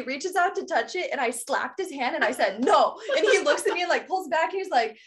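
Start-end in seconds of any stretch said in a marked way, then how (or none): 2.6-3.0 firm loud No, louder; sharp no. Retold or imitated. Guide scolding tourist reaching for turtle, sharp loud No. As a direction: snap a stern No at him.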